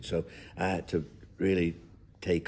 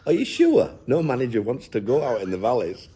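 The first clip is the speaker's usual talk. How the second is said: mock incredulous girl; 6 semitones higher, 7 dB louder than usual. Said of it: "she said".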